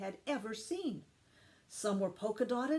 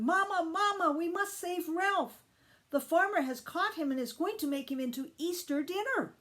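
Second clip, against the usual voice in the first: calling out; 8 semitones higher than usual. A narrator's reading. Lisa calling to her mother to save Ralph, urgent. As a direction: run and call for help.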